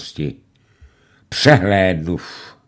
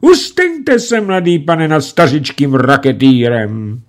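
pleading; 8 semitones higher, 7 dB louder than usual. A narrator's reading. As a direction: beg mercy for an old man. Bandit begs for pity. (prosebně, vysoko)

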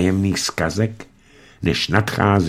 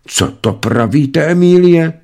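rising to a high, louder Emílie; surprised; 8 semitones higher, 3 dB louder than usual. A narrator's reading. Mistress amazed at the servant's news, surprised. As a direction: ask in astonishment. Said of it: "Tázala se paní Šarapatková překvapeně".

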